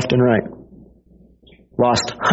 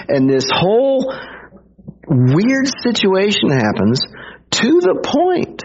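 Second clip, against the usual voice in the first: ordinary talk, nothing marked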